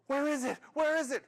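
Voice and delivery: high, eager demand; impatient excitement. Acted. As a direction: press urgently for the answer. Impatient kids demand the destination.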